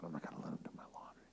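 fast whispered refusal; hushed quick whisper. Retold or imitated. Pastor retelling his refusal to the laundry offer in a fast whisper. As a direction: whisper it quickly, almost to yourself.

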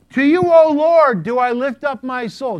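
loud, high, slow recitation into a deaf ear; loud slow Psalm reading. Retold or imitated. Pastor reading Psalms to deaf Gladys, loud and slow. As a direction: boom the Psalm right beside her head. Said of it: "just in that volume".

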